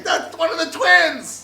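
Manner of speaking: slow, high, loud yell; screaming R2-D2. Acted. R2-D2 spotting one of the twins, alarmed scream. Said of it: "R two D two screaming".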